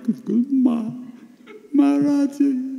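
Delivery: high, squeaky mock-tearful reply; hammed-up crying voice; acted weeping. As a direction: choke it out as if bawling. Acts answering while unable to stop crying.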